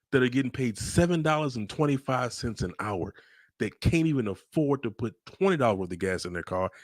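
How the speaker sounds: ordinary delivery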